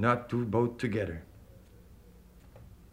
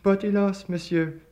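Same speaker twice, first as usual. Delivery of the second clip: higher, louder anxious voice; worried plea; 10 semitones higher, 4 dB louder than usual. A narrator's reading. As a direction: nervously warn the stranger. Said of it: "he said".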